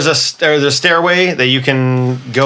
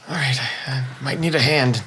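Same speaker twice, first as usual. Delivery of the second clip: weak, hushed; 2 semitones lower, 8 dB quieter than usual. Acted. Weak character asks for a hand, very soft. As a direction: ask softly, with little strength left.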